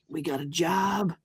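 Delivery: slow, soft and sly. Acted. Player's character pitching a job to the owl, sly. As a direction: draw it out like a secret offer. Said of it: "trying to be all sly".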